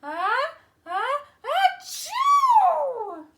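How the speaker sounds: very slow build to a loud, high triumphant achoo; mighty sneeze. Acted. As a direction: let the big sneeze finally burst out. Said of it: "then the goat sneezed".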